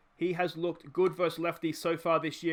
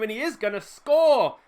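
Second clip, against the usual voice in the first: loud, raised-pitch commentator's cry; excited scoring call; 6 semitones higher, 10 dB louder than usual. Real, not acted. Commentator calls the runner home, excited. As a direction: build to a big finish on the score. Calling the go-ahead run.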